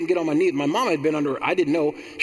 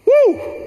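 a sudden high, loud joyful whoop; 8 dB louder than usual. Real, not acted. Preacher whoops for joy over God's peace, a loud high whoop. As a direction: let out a whoop of praise.

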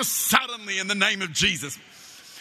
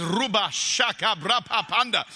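mock speaking in tongues; 3 semitones lower than usual. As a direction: imitate Peter babbling in tongues for laughs. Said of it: "Peter looks at Matthew and says".

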